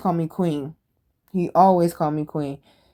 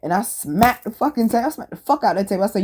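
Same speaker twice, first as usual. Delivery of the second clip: ordinary talk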